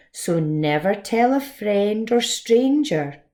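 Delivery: put-on lively rhyming read-aloud for children; story narration. A narrator's reading. Teacher reading a rhyming story to the class, lively storytime voice. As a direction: tell it bright and bouncy, like storytime.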